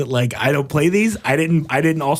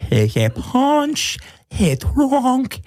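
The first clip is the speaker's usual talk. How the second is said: Adam Sandler impression; 8 semitones higher, much slower than usual. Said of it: "he grew up".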